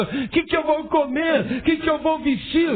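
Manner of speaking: high, worried voice asking what to eat and wear; mock worried wail; anxious fretting. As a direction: fret like someone losing sleep. Worried adult voiced in sermon.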